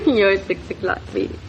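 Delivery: amused echo through a chuckle; laughing. Acted. Wife amused by husband's remark.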